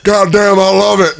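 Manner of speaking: wrestling star cheering a voice impression, loud; Stone Cold impression. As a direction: Austin hyping it up with a loud brother. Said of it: "he goes".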